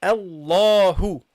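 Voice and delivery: Allahu drawn out slowly, lam full and heavy; slow heavy recitation. Shown as a demonstration. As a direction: stretch Allahu, thick on the double l. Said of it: "then you go heavy".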